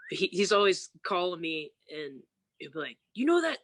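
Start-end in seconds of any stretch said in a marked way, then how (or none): 3.1-3.6 imitating an eager caller, pitched high; mock excited, high and urgent; excited brother. Brother calling sister at odd hours, excited.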